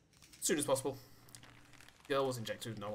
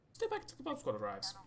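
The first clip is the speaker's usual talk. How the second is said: much higher, odd put-on accent on 'Stay back until the bomb squad arrives'; unnamed put-on accent; 9 semitones higher than usual. Shown as a demonstration. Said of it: "Don't know what that accent was".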